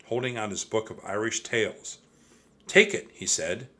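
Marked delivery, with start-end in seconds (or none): 2.7-3.1 high, loud, lightly voiced old man; insistent old monk. A narrator's reading. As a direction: press a gift on someone. Old monk pressing book on Jack.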